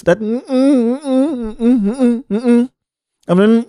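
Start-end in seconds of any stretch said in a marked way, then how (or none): 0.2-2.7 string of high, closed-mouth "mm-mm" noises; refusing hums. Retold or imitated. Turning down an older woman's offer, high refusing hums. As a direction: hum an emphatic no, over and over. Acts out refusing her advances.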